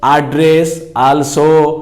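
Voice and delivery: एड्रेस also with open a vowels, very slow; slow American एड्रेस also; American pronunciation. Shown as a demonstration. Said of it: "American English में".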